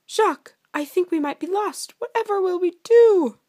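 high, loud princess voice; worried princess. Acted. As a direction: play a lost princess, pitch climbing to a wail. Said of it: "Princess Penelope realized".

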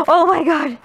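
gasp, then cry out in shock; sudden startled yelp. Real, not acted. Gasps after nearly stepping in it.

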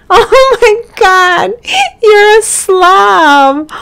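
laughing, high, loud and slow. Real, not acted. Player laughing at her Sim's slob trait. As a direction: laugh it out in disbelief.

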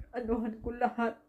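usual speaking voice

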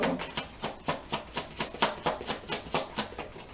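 soft, voiceless drawn-out laughter; real laugh that keeps rolling quietly; long laugh. Real, not acted. Long laugh after acted quote.

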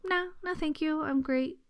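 light sing-song decline of chocolate; breezy refusal. Retold or imitated. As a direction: turn it down lightly and cheerfully. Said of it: "I went like".

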